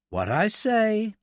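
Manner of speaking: slow, pointedly patient reply. A narrator's reading. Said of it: "Mallory was very patient".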